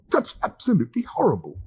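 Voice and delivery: high-pitched exclamation of horror; exclaiming. Acted. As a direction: blurt it out in disgust. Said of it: "exclaimed Arthur".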